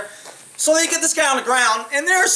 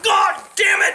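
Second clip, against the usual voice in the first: angry outburst; 10 semitones higher, slower than usual. Retold or imitated. Cop pulled off the suspect, storming off with a high angry curse. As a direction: spit it out as you storm away.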